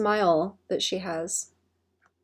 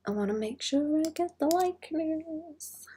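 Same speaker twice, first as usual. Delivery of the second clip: high, soft sing-song repeat; high cute voice; 8 semitones higher, 5 dB quieter than usual. Real, not acted.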